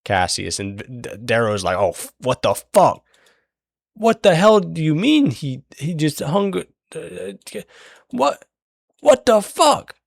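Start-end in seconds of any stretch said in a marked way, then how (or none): 1.8-9.9 higher and higher, rising in disbelief; climbing outrage, can't believe it; shocked disbelief. Retold or imitated. Darrow shocked by the hanging.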